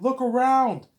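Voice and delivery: very high, loud mock yell; acting mad. Hero arguing that Neo failed, acted angry yell. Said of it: "he's all mad".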